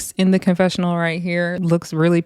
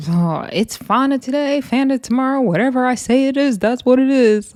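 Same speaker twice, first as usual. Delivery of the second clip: higher, mocking voice of a dismissive castmate; imitating Summer; 6 semitones higher than usual. Recapping the confessional, she mimics Summer mocking Fana. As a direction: sneer it as the smug villain. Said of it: "Summer is like".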